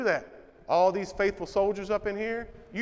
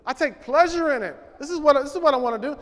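higher, louder, rushing with eagerness; eager prayer; 6 semitones higher, 6 dB louder, faster than usual. Acted. Preacher voicing a man's eager prayer to God, excited and fast. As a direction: pray it as if you can't wait. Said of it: "because, God".

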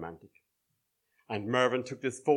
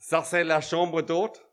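put-on French accent; 5 semitones higher, 5 dB louder than usual. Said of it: "he spoke in his best French accent".

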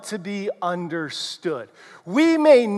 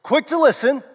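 ordinary talk

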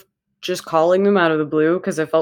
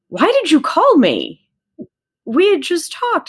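puzzled demand; 11 semitones higher, 4 dB louder than usual. Retold or imitated. Friend suddenly asks why she called.

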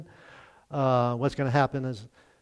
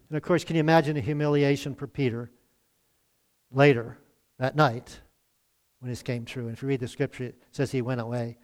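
normal talking voice

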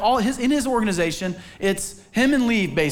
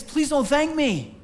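higher, slow, earnest plea; humbled, pleading please don't; pleading thanks away; 7 semitones higher, slower than usual. Retold or imitated. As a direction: beg them gently not to thank you. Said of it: "all you wanted to say is".

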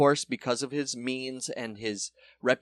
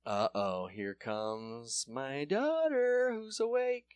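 ordinary talk, nothing marked

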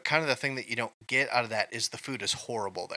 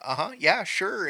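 Minnesota nice; 8 semitones higher, 4 dB louder than usual. Retold or imitated. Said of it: "everything is a".

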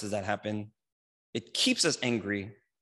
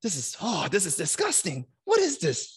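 disgusted Jesus; 5 semitones higher than usual. Retold or imitated. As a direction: act out a disgusted outburst. Jesus voiced, disgusted at temple.

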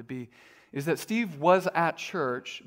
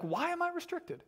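much higher, soft wondering plea; plaintive question; 13 semitones higher, 8 dB quieter than usual. Retold or imitated. Paul's readers wondering aloud, much higher and softer. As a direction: ask it high like a hurt child.

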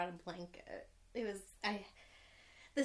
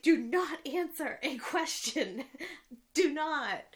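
loud, higher, slowed-down mock order, laughing; hammed-up exasperation with a laugh; mock-mad Roy; 6 semitones higher, 7 dB louder, slower than usual. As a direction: imitate an angry warning, word by word, amused. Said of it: "So Roy gets mad".

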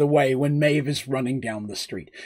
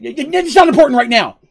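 flustered; 9 semitones higher, 7 dB louder than usual. Acted. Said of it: "Artis answering".

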